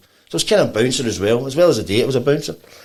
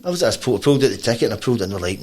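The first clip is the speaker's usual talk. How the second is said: normal talking voice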